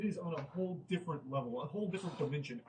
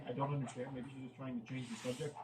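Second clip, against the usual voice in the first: ordinary talk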